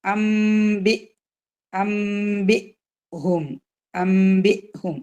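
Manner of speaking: അംബി ഹും drawn out, clipped stop before ഹും; slow chanted അംബി ഹും with a cut; recitation demo. Shown as a demonstration. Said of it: "cut ചെയ്തിട്ടാണ് നമ്മൾ അതിനെ pronounce ചെയ്യേണ്ടത്".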